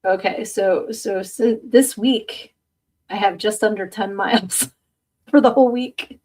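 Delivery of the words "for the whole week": breaking into a laugh at yourself; amused laughing. Real, not acted. Host admitting a tiny weekly mileage to viewers, laughing. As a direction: laugh through the words. Laughs at own low step count.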